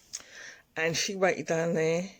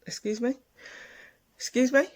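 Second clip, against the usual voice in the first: incredulous comeback, pitched up; indignant disbelief; 8 semitones higher than usual. Retold or imitated. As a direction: query it as if affronted. Retells being told she can't come.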